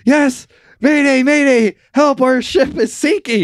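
high, loud mock panic; distress call. Sinking crew begging the coast guard, urgent mayday. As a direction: plead for rescue over the radio. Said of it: "they're like".

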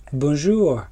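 put-on American accent in French. Shown as a demonstration. Asked to show off an American accent, put-on American French. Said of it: "Fais ton accent américain".

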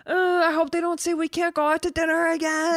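mock a pampered diner dreading lockdown; whiny reality star. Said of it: "and they're like".

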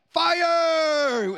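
long, high, full shout; shouted answer. Real, not acted. Host announces the riddle answer to kids, drawn-out shout. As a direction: belt out the winning answer, stretched.

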